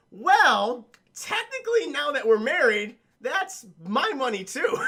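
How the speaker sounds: a gloating husband claiming her money as his own; smug husband. Retold or imitated. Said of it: "And he's like".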